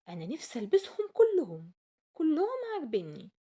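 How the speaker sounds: loud, high eager wish. Acted. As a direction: gush with greed for clothes. Aysel wants all clothes.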